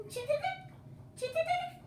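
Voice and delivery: very high chirpy jingle; tiny bird chirps; sparrow chirp. Acted. Reading sparrow call.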